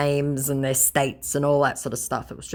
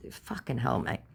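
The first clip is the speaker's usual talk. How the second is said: ordinary talk, nothing marked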